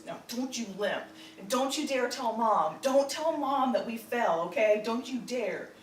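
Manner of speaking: stern warning. Retold or imitated. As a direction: warn her sternly not to tell Mom. Brother warns her not to tell.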